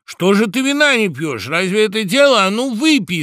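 high, loud heckling of a guest; loud demanding crowd cry; rowdy shout. A narrator's reading. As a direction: shout him into drinking. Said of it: "закричали в один голос все птицы".

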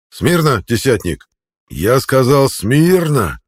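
loud, high drawn-out order; drawn-out command. A narrator's reading. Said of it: "протянул он".

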